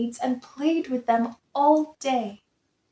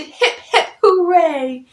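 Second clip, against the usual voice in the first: higher cheer with a laugh; happy cheer; 5 semitones higher, 5 dB louder than usual. A narrator's reading. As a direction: cheer him joyfully. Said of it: "They hooted".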